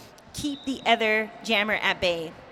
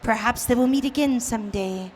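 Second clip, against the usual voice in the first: nature documentary. Pretend. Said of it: "commentate like we are David Attenborough".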